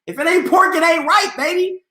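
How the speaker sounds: put-on high playful sing-song "If it ain't pork, it ain't right, baby". Shown as a demonstration.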